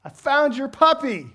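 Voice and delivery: father calling to his son down the trail, triumphant yell; yelled across trail. Retold or imitated. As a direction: shout the good news to your son. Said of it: "I yelled at Jackson".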